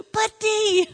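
high, loud mock plea; imitated protesting husband; pleading husband.

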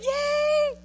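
mock cheer. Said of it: "And you all said".

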